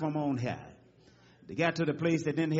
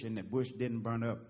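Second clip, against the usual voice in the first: normal talking voice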